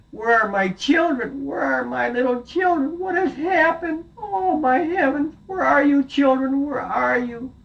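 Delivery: very high, wailing cries; distraught mother. Acted. Mother goat returns to an empty house, very high and distraught. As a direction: call desperately for lost children. Mother finds children gone.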